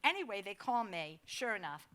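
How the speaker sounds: ordinary talk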